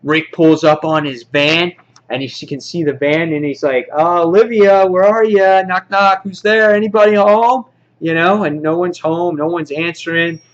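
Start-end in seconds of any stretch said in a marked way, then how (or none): 3.9-7.6 loud, raised singsong call; acted call to someone out of sight. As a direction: holler to see who's home. Rick calls out at the gate.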